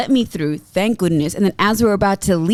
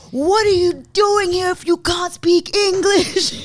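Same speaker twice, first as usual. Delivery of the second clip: high, slow mock shout; retold shout; 10 semitones higher, slower than usual. Retelling the officer shouting at a traveller, high mock shout. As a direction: imitate an officer shouting at someone, drawn out. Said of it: "he just shouted".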